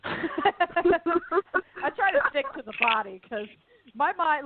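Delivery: talking through laughter; high-pitched, drawn-out words through a laugh. Real, not acted. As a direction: laugh your way through the confession. Joking about her scary mind.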